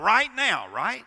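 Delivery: loud, high mocking "Right now, right?"; preacher mocks instant answers; mock question. Shown as a demonstration. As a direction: tease the congregation's impatience.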